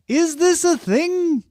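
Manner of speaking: high, drawn-out mock disbelief; incredulous question. Host quoting a doubter of obscure trends, high and incredulous. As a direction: stretch it out high, as if baffled.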